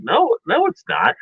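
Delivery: high-pitched mock protest; insistent denial. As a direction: imitate a kid denying everything. Acted denial in sibling squabble.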